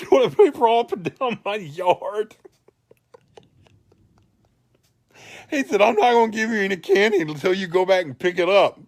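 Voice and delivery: high, loud scolding with a laugh breaking through; angry neighbor. Retold or imitated. Neighbor accusing the kid of toilet-papering his yard, loud and high.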